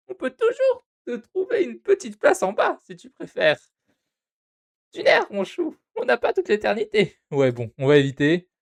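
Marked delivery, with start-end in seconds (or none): 0.1-7.2 very high, hammed-up receptionist voice. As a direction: squeak it out like a sugary desk clerk. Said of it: "je vais faire les voix quand même".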